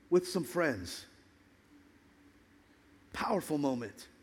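sudden low, soft drop after the climax; hushed, solemn preaching. Real, not acted. Preacher marking Jesus weeping with friends, hushed and solemn. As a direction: let it fall to a reverent hush.

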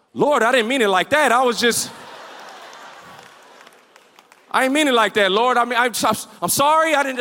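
mock panicked backpedaling, stammering; flustered apology. Man to God after the dude falls, flustered mock apology. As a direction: stammer out a hasty retold apology.

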